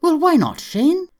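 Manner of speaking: put-on high, loud, defensive Irish girl. A narrator's reading. Irish girl answers her wincing boyfriend, defensive. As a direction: challenge him in a high, stung voice. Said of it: "she asked him defensively".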